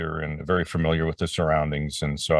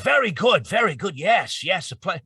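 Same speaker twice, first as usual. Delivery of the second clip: imitating an old man's curious question; elderly Stan Lee voice; 11 semitones higher than usual. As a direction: play the old comics legend asking. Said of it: "And he goes".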